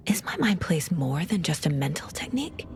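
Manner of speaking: hushed questioning to herself; whisper, wondering; puzzled whisper. Acted. Inner monologue on her mind place.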